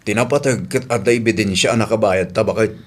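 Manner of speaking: low, put-on voice of an elderly father; old man voice. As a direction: play the old man asking his daughter. Old man repeats advice. (boses ti lakay)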